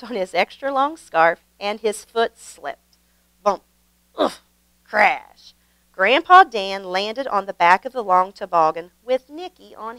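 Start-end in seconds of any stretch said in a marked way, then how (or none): none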